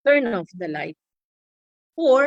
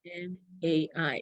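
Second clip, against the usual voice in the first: slow spelled letters; 6 dB quieter, slower than usual. Shown as a demonstration. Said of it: "That's hammering".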